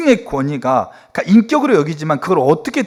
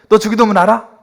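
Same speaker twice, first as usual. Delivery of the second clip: high, loud challenging question; imitated testy demand; 7 semitones higher, 6 dB louder than usual. Uncle pastor quizzing the next relative, testy. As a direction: grill them like a cross uncle. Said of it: "뭐 이렇게 막 화를 내시는 거죠".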